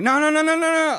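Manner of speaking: high, loud pleading no's; frantic no. Retold or imitated. Pleading with the radio company, loud frantic no's. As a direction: beg them not to cancel. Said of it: "And I'm like".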